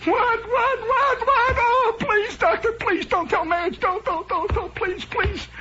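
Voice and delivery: frantic very high falsetto; panicked patient. Acted. Patient dreading the diagnosis from doctor, hammed-up panic. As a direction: babble and beg in mock terror. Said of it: "And the patient says".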